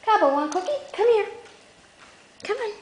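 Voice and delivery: high dog-calling voice. Real, not acted. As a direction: coax the dog over.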